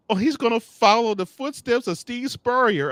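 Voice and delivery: high, gushing fan impression; imitating eager Playmaker. Host mimics Playmaker hoping for Lane, gushing high. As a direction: mimic a giddy fan's hope. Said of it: "Playmaker was thinking".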